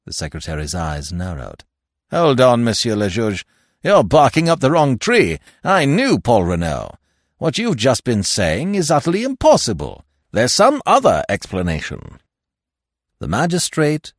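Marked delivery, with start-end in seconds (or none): 2.1-12.2 loud, high, vehement Stoner. Acted. Stoner correcting the magistrate, loud and vehement. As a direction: cut in and contradict forcefully.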